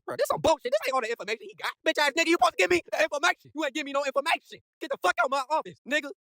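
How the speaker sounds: high-pitched, indignant mock rant; pissed off. Recapper as Goblin throwing out his informant, high pissed-off rant. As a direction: tear into them in a high, angry voice. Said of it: "he was pissed".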